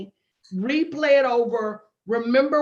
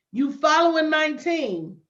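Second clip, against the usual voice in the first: ordinary talk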